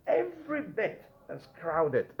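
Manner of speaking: "every bit" high and slow; emphatic EVERY BIT. Shown as a demonstration. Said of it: "you want to emphasize it".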